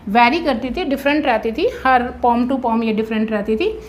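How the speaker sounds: ordinary talk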